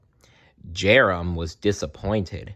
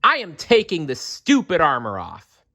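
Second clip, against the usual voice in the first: put-on mad boy; 8 semitones higher than usual. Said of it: "But Jerem was mad".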